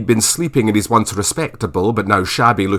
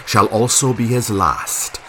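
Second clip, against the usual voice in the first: usual speaking voice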